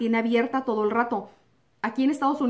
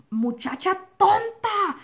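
slow, scornful teacher's put-down, pitched up; harsh teacher; 7 semitones higher, much slower than usual. Retold or imitated. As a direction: spit it out slowly like a mean teacher. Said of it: "Me dijo". (maestra despectiva, lenta y aguda)

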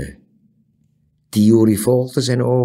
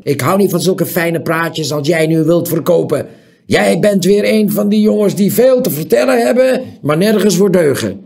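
angry; 8 semitones higher, 7 dB louder than usual. A narrator's reading. Angry owner scolds the boy.

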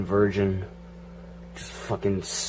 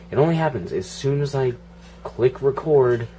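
ordinary delivery

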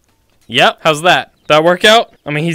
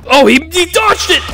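high, excited cry; shrieked surprise; astonished shout; 11 semitones higher than usual. Real, not acted. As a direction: exclaim in sudden disbelief. Startled by dodge.

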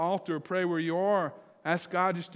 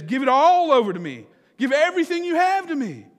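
loud, high preacher's cry as God; impassioned divine appeal; 9 semitones higher, 11 dB louder than usual. Acted. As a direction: call out God's command with full force. Preacher voices God calling people.

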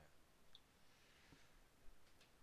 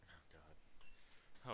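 whispered dread; 3 semitones lower, 7 dB quieter than usual. Real, not acted. Player dreading the roll.

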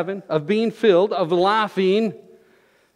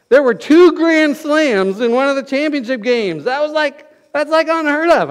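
louder and higher, worked up over the grand slams; real excitement; 7 semitones higher, 5 dB louder than usual. Real, not acted. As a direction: let the sports excitement carry you away. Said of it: "All right, I'm getting excited now".

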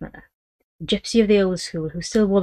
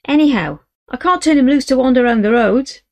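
desperate yell; 7 semitones higher, 8 dB louder than usual. Acted. Boy yelling back at father.

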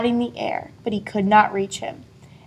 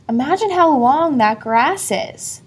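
usual speaking voice

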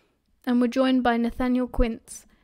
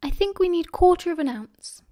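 chattering; 6 semitones higher than usual. A narrator's reading. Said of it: "Lily was already chattering away".

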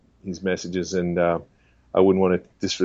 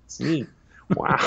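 higher-pitched actor impression of casual wonder; Owen Wilson; 5 semitones higher, slower than usual. Movie star attempting Shakespeare, casually awed put-on voice. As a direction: recite it lightly, then marvel casually. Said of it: "the guy could do Shakespeare".

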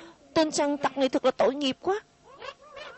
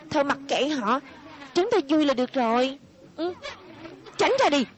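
normal talking voice

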